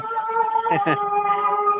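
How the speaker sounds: short high amused laugh. Real, not acted. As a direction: laugh it off at the argument. Laughs at repeated answer.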